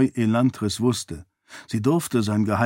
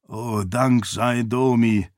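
ordinary delivery, nothing marked

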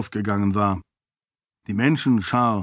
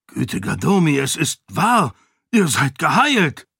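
higher, louder astonished cry; guard can't believe his eyes; 4 semitones higher, 3 dB louder than usual. A narrator's reading. Guard at the gate astonished that the lord is healed.

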